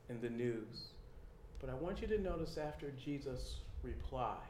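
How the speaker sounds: ordinary talk, nothing marked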